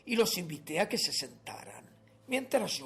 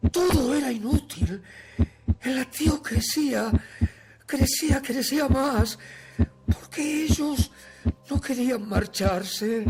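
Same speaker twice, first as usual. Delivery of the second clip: higher, louder, frantic narration; mounting panic; 6 semitones higher, 5 dB louder than usual. Acted. Murderer hearing the heartbeat grow, mounting panic. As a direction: build urgently as the dread takes over.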